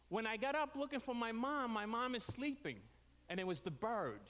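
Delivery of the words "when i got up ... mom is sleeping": ordinary talk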